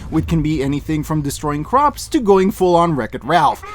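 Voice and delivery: ordinary talk, nothing marked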